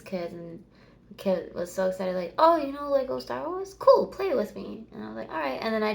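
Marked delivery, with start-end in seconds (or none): none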